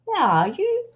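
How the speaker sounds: put-on high singsong little-boy call; child calling out. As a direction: mimic a small child searching for something. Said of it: "if he's looking for something".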